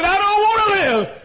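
high, impassioned protest; anguished outcry. Retold or imitated. Preacher answering worried deacons about his health, anguished outcry. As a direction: burst out high, then fall away.

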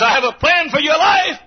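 high-pitched shouted offer; acted high call from the world. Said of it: "The world says".

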